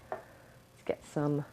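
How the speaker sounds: slow, whispery murmur; soft, absent-minded whisper; muttering to self. Real, not acted. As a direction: trail it out under your breath. To herself while fetching charms.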